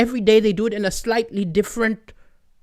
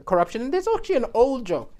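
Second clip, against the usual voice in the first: ordinary talk, nothing marked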